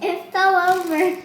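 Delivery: loud, high giggly cry; excited cry. Real, not acted. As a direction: announce it gleefully. Camera falls again.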